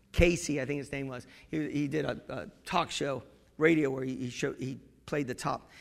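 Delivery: normal talking voice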